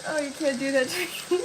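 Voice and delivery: too tired to stop laughing, words dragged out. Real, not acted. Streamer to chat, giggling helplessly at a name. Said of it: "I just start laughing at everything".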